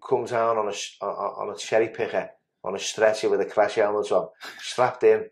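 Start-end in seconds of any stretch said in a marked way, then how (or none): none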